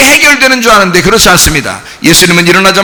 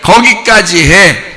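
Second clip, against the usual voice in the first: slow calm dismissal; much slower than usual. Shown as a demonstration. Preacher to the congregation, a slow calm send-off. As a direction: say it slowly and calmly. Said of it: "그랬어요?".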